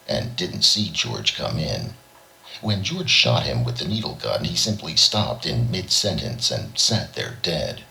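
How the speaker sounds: usual speaking voice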